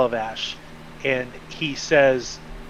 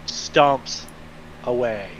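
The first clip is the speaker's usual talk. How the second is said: ordinary talk, nothing marked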